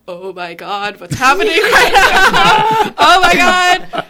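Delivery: high, loud laughing oh my God; giddy shocked laughter; excited disbelief. Retold or imitated. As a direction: squeal it out through a laugh. Shocked at being handed the role.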